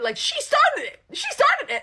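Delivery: very high, loud squeal of delight; giddy gossip thrill; gleeful. Real, not acted. Teller delighting in the couple's fight, high and loud.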